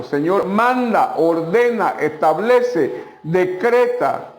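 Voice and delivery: each command drawn out slowly, one by one; slow pleading commands. Acted. Peter slowly begging Jesus to command. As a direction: plead slowly, weighing every verb. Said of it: "decretara un milagro".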